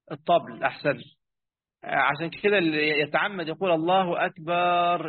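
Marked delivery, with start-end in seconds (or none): 3.7-5.1 "أكبر" stretched into "أكبار" with a long a; "الله أكبر" elongated like a long call; drawn-out takbir. Shown as a demonstration. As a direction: slowly draw the a of "أكبر" out. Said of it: "اللي هو بيمد".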